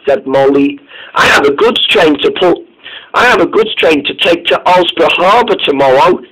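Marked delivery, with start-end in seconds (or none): none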